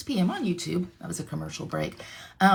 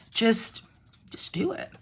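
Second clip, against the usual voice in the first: hushed, urgent just do it; whispered encouragement; 3 semitones higher than usual. Real, not acted. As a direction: whisper it like a secret pep talk. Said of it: "coaching the viewers".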